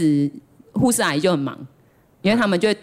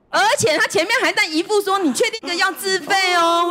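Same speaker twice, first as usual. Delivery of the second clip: mock doctor; 11 semitones higher than usual. Mocking the doctor's doubt.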